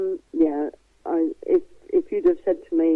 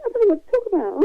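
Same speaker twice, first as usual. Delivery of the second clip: flustered; 13 semitones higher, faster than usual. Retold or imitated. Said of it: "I went".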